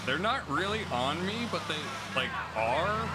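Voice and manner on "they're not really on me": normal talking voice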